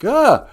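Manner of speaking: short, high, loud startled yelp; mock scream of sudden fright. Cop startled at his car, high mock yelp. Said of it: "and the cop was like".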